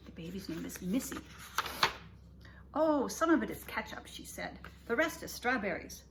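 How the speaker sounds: gentle read-aloud for little listeners; storybook reading. A narrator's reading. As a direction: read it like bedtime story time. Reading picture book to kids.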